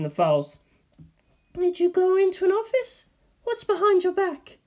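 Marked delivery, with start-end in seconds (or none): 1.5-4.6 high, sugary receptionist; a high front-desk voice with an edge; suspicious receptionist. Acted. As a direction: receptionist catching an intruder, high and sweet. Receptionist interrogates.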